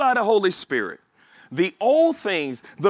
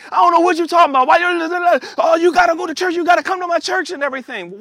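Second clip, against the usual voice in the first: higher, louder insistent urging; zealous convert; 11 semitones higher, 5 dB louder, faster than usual. Retold or imitated. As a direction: push them to come to church. New convert pressing relatives.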